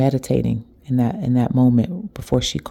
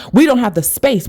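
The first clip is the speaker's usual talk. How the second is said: much higher and insistent, rising at the end; peak urgent plea; 10 semitones higher than usual. Retold or imitated. Spirit telling mother there is no room for sadness, peak plea. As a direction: climb even higher, begging her to listen. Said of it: "and it said to her".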